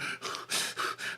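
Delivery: breathy gasping imitation; heavy panting breath. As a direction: gasp like a fish out of water. Breathing hard like fish.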